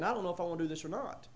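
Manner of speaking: low, soft, hurried thinking aloud; quick, quiet acted hesitation; second thoughts. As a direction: voice a diver's doubts under the breath. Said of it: "and you're thinking".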